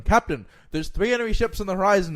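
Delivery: high, loud alarm call; yelling down. Acted. Lookout spots enemy ships from crow's nest, yelling alarm. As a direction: yell it down from the lookout. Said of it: "yells down".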